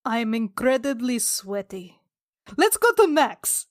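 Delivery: usual speaking voice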